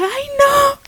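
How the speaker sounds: high, drawn cry of dismay; retold dismay. Mother showing how she answered the doctor, high dismayed cry. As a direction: re-enact the shock, pitch leaping up. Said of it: "Así mismo le dije".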